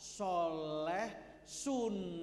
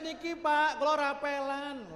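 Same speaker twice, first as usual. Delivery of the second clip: mock neighbourly offer; 5 semitones higher, 6 dB louder than usual. Civil servant calling neighbour about back pay, mock generous offer. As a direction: play a civil servant announcing a share-out, hammed up.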